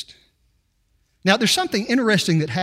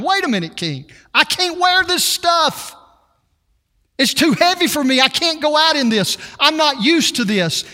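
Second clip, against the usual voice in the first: higher, pleading protest; complaining young shepherd; 7 semitones higher than usual. Retold or imitated. David protesting to King Saul, higher pleading voice. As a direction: squirm under heavy armor. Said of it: "he says in verse thirty-nine".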